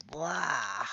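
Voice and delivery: low, soft mock retch; a half-hearted, queasy "blah"; seasick retch. Froggy a little seasick on the boat, mock retch.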